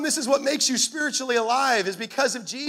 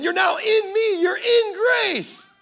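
tearful; 9 semitones higher, slower than usual. Retold or imitated. Pastor voicing God's words to a believer, tearful and slow. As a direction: voice cracking, very slow on grace. God's voice, tender retold promise.

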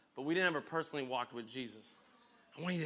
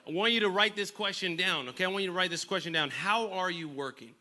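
ordinary talk

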